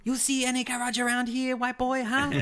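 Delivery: hammed-up Chinese-accented outback mechanic, mocking. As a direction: lord it over the stranded driver in a cartoon Chinese accent. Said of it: "Chinese automobile, like, mechanic guy".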